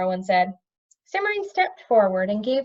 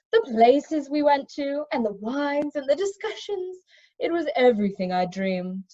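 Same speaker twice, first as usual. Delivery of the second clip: dreamy; 5 semitones higher than usual. A narrator's reading. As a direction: drift into fond memory.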